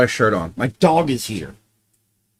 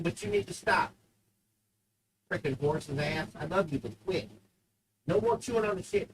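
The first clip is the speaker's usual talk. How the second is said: soft scolding; 10 dB quieter than usual. Real, not acted. Soft scolding of his dog.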